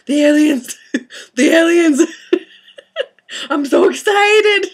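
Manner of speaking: very high, loud, laughing near tears; giddy alien news. Retold or imitated. Daughter telling mom about the aliens, giddy and squealing. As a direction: burst with excitement. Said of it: "It's like".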